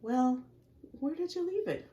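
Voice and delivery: high, playful character question; high teasing voice. Acted. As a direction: ask it like a puzzled sea creature. Voicing a character in picture book.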